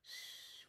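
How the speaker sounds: voiceless shh, very soft; soft shush. A narrator's reading. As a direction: hush them to sleep. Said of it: "went Lulu".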